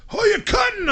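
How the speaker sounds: imitating angry, high-pitched heckle; pissed-off crowd. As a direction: yell it like an annoyed bystander. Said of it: "Everybody was pissed".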